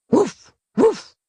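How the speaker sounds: high playful woofs; dog barking. Acted. The dogs answering Nieto with high playful woofs. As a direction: bark back like small eager dogs.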